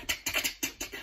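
unvoiced tick sounds, very soft; whispered clicking. Imitating Grandad playing spoons, soft whispered ticks. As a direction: tap out a rhythm under your breath.

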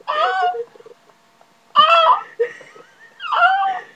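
very high, sharp crow caws. Pretend. The crow passing the window, shrill caws. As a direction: caw like a crow flying past.

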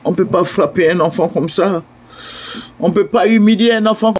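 ordinary talk

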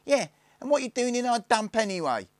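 very high, loud, fierce challenge; fierce piping. A narrator's reading. Youngest joins the questioning, fierce piping shout. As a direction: pipe up fiercely, top of the voice. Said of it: "piped up the youngest fiercely".

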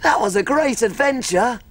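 put-on lively storybook reading to children; cheerful storyteller narrating an adventure; story narration. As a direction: tell the tale like a picture book read aloud. Reads story of Little Cook.

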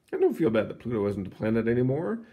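usual speaking voice

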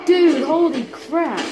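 high, loud, drawn-out disbelief; shocked exclamation. Real, not acted. Gamer reacting to the fight, shocked exclamation. Startled by the game.

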